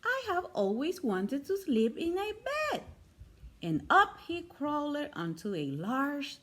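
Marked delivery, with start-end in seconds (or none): 0.0-2.8 higher, wistful teddy bear voice; dreamy little bear. A narrator's reading. Toy bear voiced in story.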